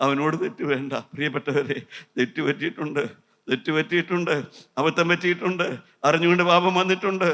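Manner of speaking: normal talking voice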